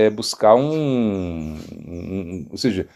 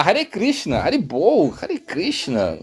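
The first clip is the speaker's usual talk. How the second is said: mock wail after stubbing a toe; acted pained outcry; 6 semitones higher, much slower than usual. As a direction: cry out the holy names as if hurt. Cries out after stubbing a foot.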